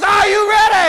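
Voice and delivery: loud, high-pitched yelled check; jump master's shout. Retold or imitated. Jump master to jumper at the door, shouting. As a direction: shout it over the plane's noise.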